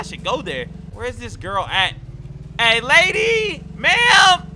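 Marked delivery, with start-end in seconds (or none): 2.6-4.5 high, loud, drawn-out call with a cracking voice. Acted. Calling after the runaway girl, voice cracking. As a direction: holler till the voice cracks. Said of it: "my voice is cracking".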